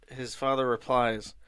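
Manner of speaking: normal talking voice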